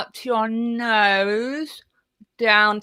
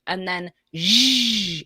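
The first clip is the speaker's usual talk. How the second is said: zh sound; 2 semitones lower, slower than usual. Shown as a demonstration. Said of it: "do some voiced sounds".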